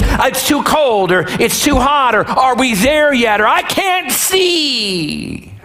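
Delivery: mock childish whine building to a long wail; whining backseat child. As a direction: whine like a kid in the back seat, dragging out the last words. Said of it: "we're whining, and we're complaining, and we're throwing a hissy fit".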